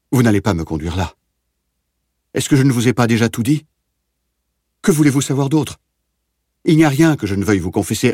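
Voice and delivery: frantic pleading. A narrator's reading. Prisoner begs the officer to spare him, rising panic. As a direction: beg for your life, voice climbing into panic. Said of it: "cria-t-il".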